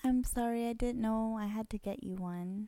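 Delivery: soft faux-apologetic sorry with a rise; passive aggressive. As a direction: apologize while twisting the knife. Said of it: "That sounds passive aggressive".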